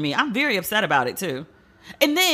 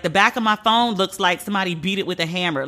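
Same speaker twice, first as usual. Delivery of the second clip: normal talking voice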